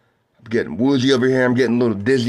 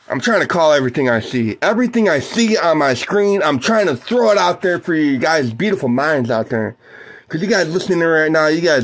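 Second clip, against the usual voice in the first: ordinary talk, nothing marked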